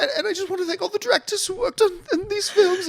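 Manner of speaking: put-on high, quick weepy fangirl with English accent; imitating a crying English fan; mock tearful. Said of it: "she's already crying before the trailer".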